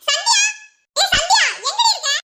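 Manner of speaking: loud, high call across the house; shouting for Sandhya. Acted. Sister shouts for Sandhya to come, loud call. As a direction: yell her name to make a scene. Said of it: "எதுக்கு கத்துற?".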